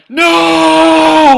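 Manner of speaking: long, high, very loud "no"; hammed-up cry of defeat; anguished scream. Gen losing the brooch to Aldrich, drawn-out anguished shout. Gen's prize is snatched away.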